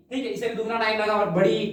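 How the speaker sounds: ordinary delivery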